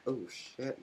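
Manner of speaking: whispered "shit" in surprise; a sudden whispered swear; hushed curse. Real, not acted. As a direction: curse under the breath, caught off guard. Startled aside, unvoiced.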